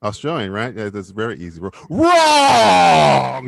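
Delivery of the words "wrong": loud "Wrong!" buzzer call; shout. Real, not acted. Quiz host shouting that the guess is wrong. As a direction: bellow it like a game-show buzzer.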